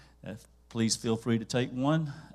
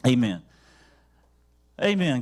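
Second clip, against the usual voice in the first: called amen; 6 dB louder than usual. Real, not acted. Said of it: "And everybody said".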